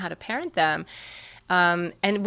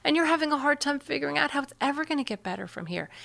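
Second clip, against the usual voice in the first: empathic listening; 7 semitones higher, 3 dB quieter than usual. Shown as a demonstration. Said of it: "be brave enough to really just stay".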